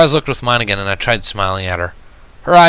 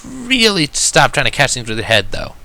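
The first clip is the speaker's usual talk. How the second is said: teasing; 7 semitones higher than usual. A narrator's reading. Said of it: "winking at me".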